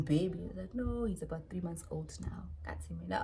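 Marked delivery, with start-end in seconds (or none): none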